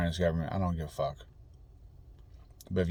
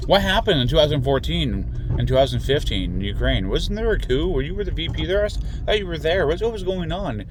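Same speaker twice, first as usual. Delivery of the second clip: higher, louder, pointed string of mock questions; mock questioning; 9 semitones higher, 5 dB louder than usual. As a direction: fire off the questions as if cross-examining.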